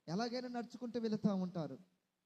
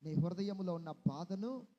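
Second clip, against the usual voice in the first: tender comfort; 7 semitones lower than usual. Acted. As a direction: comfort a weeping mother gently. Comforting a grieving listener.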